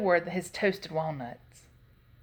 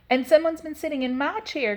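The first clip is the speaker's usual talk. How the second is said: higher middle-sized bear voice; Mama Bear; 7 semitones higher, 4 dB louder than usual. A narrator's reading. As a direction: cry it as an indignant mother bear. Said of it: "cried Mama Bear in her middle-sized voice".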